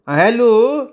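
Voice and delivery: high, loud, sing-song "hello" stretched out; drawn-out hello. Acted. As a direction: call "hello" into the phone with a long drawl. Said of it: "पारूनं हेल काढला". (लांब हेल काढून उंच, मोठ्याने "hello")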